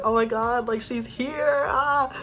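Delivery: high fangirl squeal, rising as it goes; re-enacting a thrilled fan freaking out; acted starstruck excitement. Acts out seeing idol in studio.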